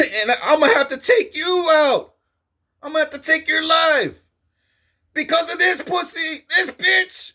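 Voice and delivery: rant, building to a yell; retold threat rising to a shout; angry tirade.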